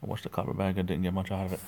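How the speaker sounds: usual speaking voice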